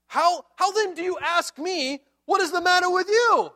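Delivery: high, incredulous protest; acted outrage climbing in pitch and volume; indignant Micah. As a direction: throw their question back, loud and outraged.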